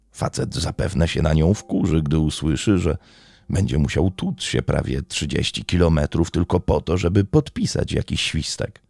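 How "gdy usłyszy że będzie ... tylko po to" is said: ordinary talk, nothing marked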